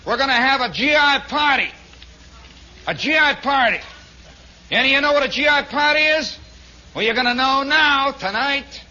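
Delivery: loud, high-pitched sergeant announcement. Acted. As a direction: call it out to the whole barracks. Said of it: "And the sergeant says".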